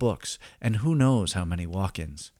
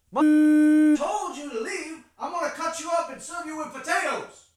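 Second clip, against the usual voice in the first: angry chef voice. Acted. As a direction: threaten the narrator like a mad chef. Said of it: "The narrator can take a hint".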